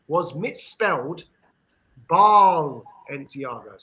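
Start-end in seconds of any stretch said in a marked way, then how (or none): 2.1-3.8 "Balenciaga" drawn out very slowly and louder, Baal at the front; misspelling spelled out. Shown as a demonstration. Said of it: "so B double A L".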